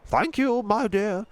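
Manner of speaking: put-on gentle old tramp voice, a different timbre; old tramp Mister Stink. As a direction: speak like a kindly old vagrant.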